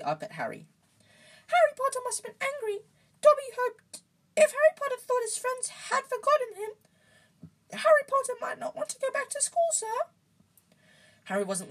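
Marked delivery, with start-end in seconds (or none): 1.5-9.9 very high, loud squeaky elf; piping little creature, worried; anxious house-elf. A narrator's reading. Voices Dobby the house-elf.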